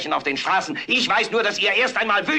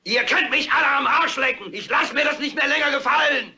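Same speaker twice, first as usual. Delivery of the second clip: yelled out the window; 7 semitones higher than usual. Acted. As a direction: shout it out of the window in fury. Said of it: "den Kopf raussteckt und schreit".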